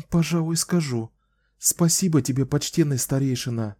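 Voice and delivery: put-on a young woman apologising. A narrator's reading. Kahlan thanks elder. (молодая героиня)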